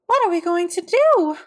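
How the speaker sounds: high, loud wail of a desperate mother; mother crying out in despair; distressed cry. A narrator's reading. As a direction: cry out at wit's end. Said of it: "cried Missus Cream".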